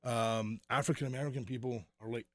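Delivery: ordinary delivery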